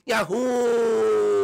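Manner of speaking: long, held cartoon cheer; jubilant whoop. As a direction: shout it out and sustain the last vowel. Both start to play.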